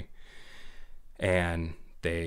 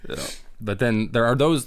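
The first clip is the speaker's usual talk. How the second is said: normal talking voice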